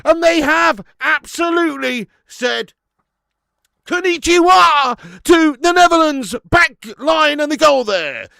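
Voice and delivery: screamed, sky-high goal commentary, slowing on key words; ecstatic goal shout. Real, not acted. Streamer celebrating a late Japan equaliser, ecstatic screaming. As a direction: roar it like the winner just went in.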